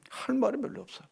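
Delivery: ordinary talk, nothing marked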